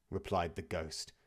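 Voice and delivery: ordinary delivery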